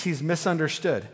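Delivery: usual speaking voice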